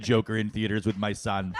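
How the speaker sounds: ordinary delivery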